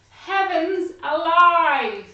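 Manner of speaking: loud, slow "Heavens alive"; exasperated old lady. Acted. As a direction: cry out in dismay. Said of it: "the little old lady said".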